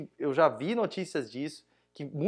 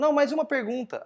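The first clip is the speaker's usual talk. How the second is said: high, raised interjection; retold insistent demand; 9 semitones higher than usual. Demanding another question from the speaker on stage, retold raised insistence.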